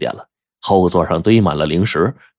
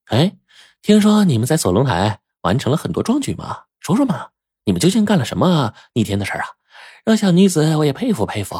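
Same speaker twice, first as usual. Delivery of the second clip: put-on higher, playful wheedling girl; lightly pitched-up teasing; coaxing young lady; 7 semitones higher than usual. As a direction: coax the story out of them. Bai Chengcheng coaxes them to tell.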